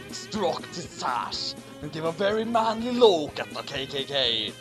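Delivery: usual speaking voice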